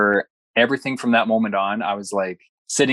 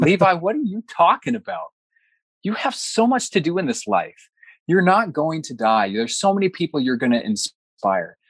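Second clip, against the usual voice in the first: insistent reassurance; 7 semitones higher than usual. Retold or imitated. Wife rallies him, retold.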